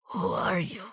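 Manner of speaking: weak, soft, forced out through pain; pained and choked. A narrator's reading. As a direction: barely get the words out. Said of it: "choked out some painful words".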